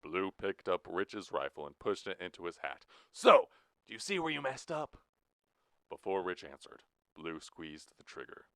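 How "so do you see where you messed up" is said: high, loud sneering question; menacing taunt. Acted. Blue taunting Rich before pulling the trigger. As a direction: jeer at him before the shot.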